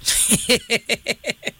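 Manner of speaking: rapid, rippling giggle; chuckle. Real, not acted. Host reacting to caller's party comparison, chuckling. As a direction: let out a string of quick chuckles.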